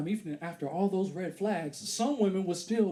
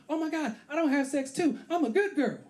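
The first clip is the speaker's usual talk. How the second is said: put-on high, girlish voice of a date; lightly mocked female voice; imitated woman; 7 semitones higher than usual. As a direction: pitch it up as an eager young woman. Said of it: "She stopped me".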